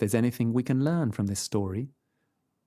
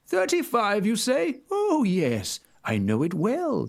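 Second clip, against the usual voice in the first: higher sly wolf with a smile; smiling wolf; 9 semitones higher, slower than usual. A narrator's reading. Wolf pleased to learn the house number, smiling. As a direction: grin through it, scheming. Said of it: "with a smile".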